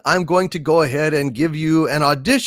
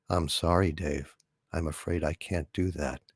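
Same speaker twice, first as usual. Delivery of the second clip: much lower and much softer, calm and even; HAL computer voice; 12 semitones lower, 12 dB quieter than usual. Acted. The ship computer answering astronaut Dave, low, calm and soft. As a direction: speak low, soft and unhurried like a polite machine. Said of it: "from two thousand and one, A Space Odyssey".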